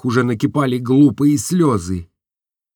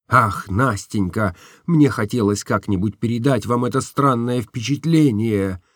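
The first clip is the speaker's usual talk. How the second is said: plain reading voice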